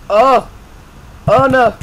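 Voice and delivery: high, loud "oh no"; alarmed cry. Real, not acted. Gamer reacting to sudden danger, alarmed.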